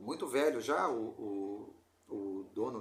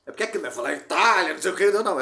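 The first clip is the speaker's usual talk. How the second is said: laughing aside; 10 dB louder, faster than usual. Real, not acted. Laughing mock aside on Italy.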